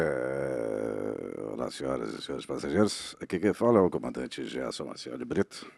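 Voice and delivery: low, slow airline captain announcement; lightly voiced cabin announcement; pilot over the radio. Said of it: "Ah, sou eu agora".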